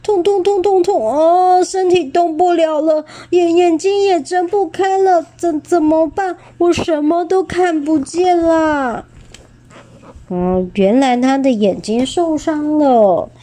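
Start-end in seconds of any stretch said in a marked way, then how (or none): 0.0-9.0 high whimpering cry of pain; sad, in pain. A narrator's reading. Injured dinosaur who cannot see, higher pained wailing. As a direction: whine it out like a hurt, blinded beast. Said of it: "霸王龙很伤心地说".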